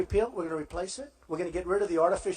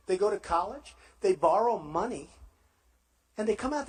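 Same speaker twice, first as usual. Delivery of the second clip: usual speaking voice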